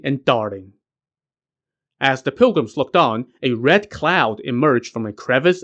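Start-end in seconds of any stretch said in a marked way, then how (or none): none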